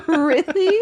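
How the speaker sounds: loud laughing really; laughing disbelief. Real, not acted. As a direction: shout it through a laugh. Incredulous laughing shout.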